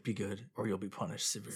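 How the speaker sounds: soft, low menacing murmur; hushed threat. Retold or imitated. Santa threatens the child on his lap, hushed monotone. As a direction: warn quietly, with little expression.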